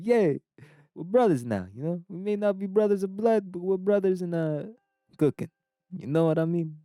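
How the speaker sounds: high mock-Italian brotherly talk; high-pitched Italian caricature; Italian accent. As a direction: bond in a comic Italian accent. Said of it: "I'm not even Italian".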